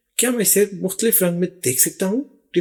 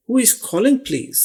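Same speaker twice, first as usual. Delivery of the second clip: slow and careful, a bit higher; slow model phrase; 5 semitones higher, much slower than usual. Shown as a demonstration. Teacher modelling how to answer a phone call slowly. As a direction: lift it gently and go slow. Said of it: "teaching phone phrase".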